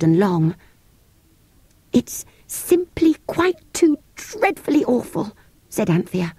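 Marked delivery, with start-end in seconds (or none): 1.9-5.3 put-on high, slow dismayed girl; little girl wailing at disaster. A narrator's reading. Anthea voiced high, slow.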